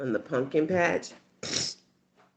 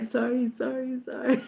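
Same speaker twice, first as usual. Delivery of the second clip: high, hammed-up sorry to the pumpkin; playful mock sorry, much higher; mock apology; 11 semitones higher than usual. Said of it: "To the pumpkin, like".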